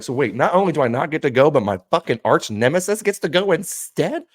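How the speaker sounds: a touch of mock fury; indignant disbelief. Retelling hearing the news, indignant disbelief.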